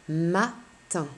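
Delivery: "matin" in two beats, ma, pause, tin; split into syllables. Shown as a demonstration. Teacher gives pupil the last word, "matin" split in two syllables. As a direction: separate "matin" after ma. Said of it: "j'entends deux syllabes".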